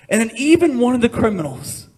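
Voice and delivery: ordinary talk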